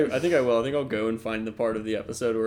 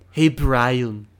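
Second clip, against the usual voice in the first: cartoon-character voice on the name; Stewie Griffin; 6 dB louder than usual. Friend gives a tease of his cartoon voice, mimicking. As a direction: put on a cartoon voice, drawing out the name. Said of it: "trying to perfect my Stewie Griffin voice".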